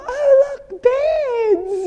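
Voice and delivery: high, loud gushing over beds; mock delight. Retelling her bed fixation in the city, high, loud mock delight. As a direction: squeal at the sight of beds. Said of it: "I'd walk through the city and go".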